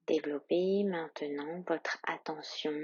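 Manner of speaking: usual speaking voice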